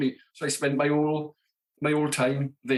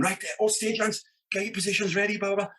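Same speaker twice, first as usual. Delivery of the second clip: announcer call; 8 semitones higher than usual. Retold or imitated. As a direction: imitate security barking orders at stagehands. Security calls out to stagehands.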